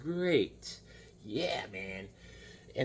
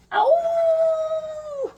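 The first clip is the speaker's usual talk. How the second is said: very high, louder hammed-up wolf howl; werewolf howl; 6 dB louder than usual. Shown as a demonstration. Vlogger demonstrates the werewolf, loud high mock howl. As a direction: show them the werewolf's howl. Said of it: "This is the werewolf".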